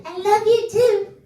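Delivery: put-on little boy voice. Kindergartner telling daddy he loves him, high child voice. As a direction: chirp it back like a little kid. Son answers dad.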